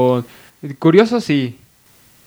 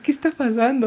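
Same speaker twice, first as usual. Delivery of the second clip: mock scolding; 9 semitones higher than usual. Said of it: "me empezó a gritar y me empezó a regañar".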